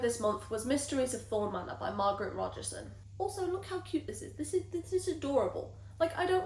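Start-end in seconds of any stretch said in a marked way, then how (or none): 3.2-6.4 gushing high voice. Real, not acted. Gushing over cute book edition.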